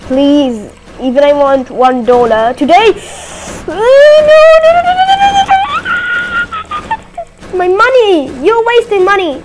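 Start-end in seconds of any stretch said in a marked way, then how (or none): 3.7-7.6 very high, loud string of desperate noes; shrieking no. Real, not acted. Gamer watching the money vanish, shrieking. 7.6-9.4 higher and louder, scolding the thief; outraged cry over lost cash; upset yell. Real, not acted. Player shouting at whoever wastes the money, upset yell.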